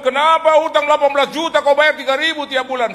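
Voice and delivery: high, loud indignant scolding; mock outraged shout; angry collector. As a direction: tell him off for paying so little.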